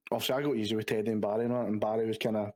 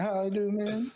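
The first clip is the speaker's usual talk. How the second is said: put-on over-the-top American accent, much higher; 9 semitones higher, much slower than usual. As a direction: ham up a mad American greeting. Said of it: "Mad American guy".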